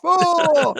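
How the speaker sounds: high, drawn-out mock "oh" boom; imitated cannon blast. Podcaster wishing they showed the cannon, imitated blast. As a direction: sustain it high, breaking into laughter.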